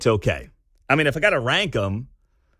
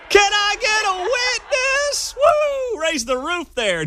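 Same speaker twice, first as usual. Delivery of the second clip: very high, hollered mock Southern accent; slower than usual. Host aping Harris's witness call, high hammed-up Southern holler. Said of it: "we'll call it the Southern accent".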